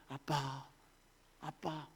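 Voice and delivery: very soft, almost breathless call; barely above a breath, lovingly; a child softly calling daddy; tender hush. Acted. Preacher softly calls God Daddy.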